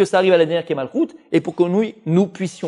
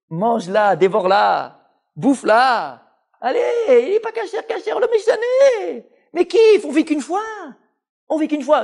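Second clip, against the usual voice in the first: much higher, sly tempter urging indulgence; high mocking devil on the shoulder; tempting Yetzer Hara; 10 semitones higher than usual. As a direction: lure them in, giggling and wheedling. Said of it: "c'est Yetzer Hara".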